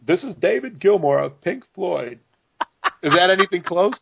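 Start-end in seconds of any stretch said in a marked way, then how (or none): 0.0-2.2 slow, badly faked British accent. Co-host showing off worst accent skills, bad British impression. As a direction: attempt an English rock star's accent, slowly. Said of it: "so I'm gonna do one for you".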